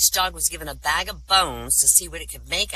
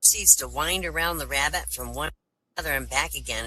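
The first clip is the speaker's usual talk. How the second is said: ordinary delivery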